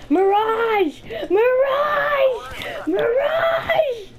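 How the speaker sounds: shouting in gleeful excitement; excited yell. Real, not acted. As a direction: yell it out in triumph, climbing each time. Yelling enemy's name excitedly.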